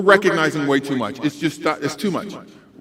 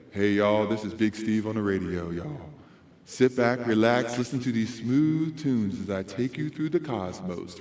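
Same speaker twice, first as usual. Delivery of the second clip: very low, soft, mellow radio croon; smooth late-night host easing listeners back; nighttime DJ voice; 8 semitones lower, 7 dB quieter than usual. Shown as a demonstration. Radio DJ to late-night listeners, smooth and mellow. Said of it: "That's our nighttime D J voice".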